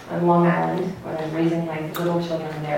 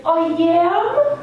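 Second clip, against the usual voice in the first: surprised Long Island question; 11 semitones higher, 5 dB louder than usual. Retold or imitated. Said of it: "She had a very Long Island accent".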